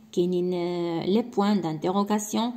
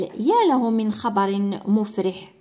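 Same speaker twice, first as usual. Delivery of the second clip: slow, louder exclamation; exclamation tune; 4 dB louder, slower than usual. Shown as a demonstration. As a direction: sound glad and emphatic. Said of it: "بوان ديكسكلاماسيون". (بنبرة تعجب)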